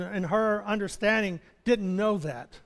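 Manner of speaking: ordinary talk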